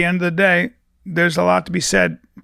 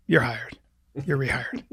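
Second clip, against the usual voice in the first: sadistic boss; 3 semitones lower, 6 dB quieter than usual. Pretend. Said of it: "You're the sadistic boss".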